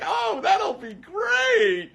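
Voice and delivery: very high, slow, shouted falsetto; mock delighted consumer. Ridiculing consumer culture on his stream, shrieking falsetto. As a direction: gush like a thrilled shopper.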